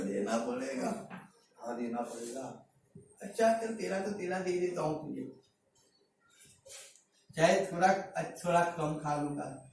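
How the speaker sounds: very low, soft self-talk; reluctant giving-in. Acted. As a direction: give in quietly, half to yourself. Voices a believer giving in reluctantly. (अनमने मन से, धीमी दबी आवाज़ में)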